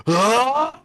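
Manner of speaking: high, booming monster cry; a sudden telepathic roar; magically amplified voice. Acted. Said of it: "booming voice just erupts in all of your minds".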